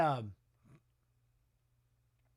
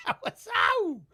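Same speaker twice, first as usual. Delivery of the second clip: sharp ow; 13 semitones higher, slower than usual. Real, not acted. Reacting to a hit on screen.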